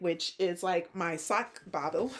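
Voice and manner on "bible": Bible drawled in a Southern Georgia twang; Georgia twang slip. Real, not acted. Tired knitting vlogger's Georgia twang slipping out on Bible. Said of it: "there are times when the twang comes out".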